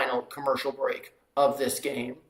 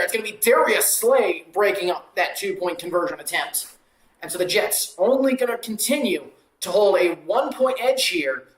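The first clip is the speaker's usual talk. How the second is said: rising excitement as the pass is broken up, louder and higher at the end; live play-by-play; 4 semitones higher, 5 dB louder than usual. Acted. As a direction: call the two-point try like a TV announcer.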